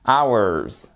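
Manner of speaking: "Hours" like "ours", H dropped; silent H. Shown as a demonstration. Teacher repeats "Hours" for the class, no H. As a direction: say "Hours" with no breath of H. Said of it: "ça se prononce exactement comme notre".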